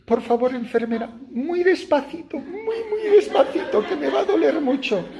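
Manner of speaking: begging coward. Retold or imitated.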